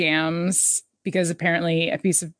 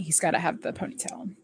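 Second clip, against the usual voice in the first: whispered aside; 9 dB quieter than usual. Real, not acted. Playful aside about the doctor.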